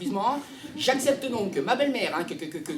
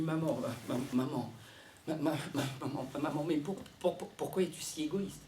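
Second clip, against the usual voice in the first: low, hushed, halting plea; timid stammer; 6 semitones lower, 10 dB quieter than usual. Acted. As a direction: stumble softly toward the reproach. Son stammers timidly to his mother. (bégaiement timide à voix basse)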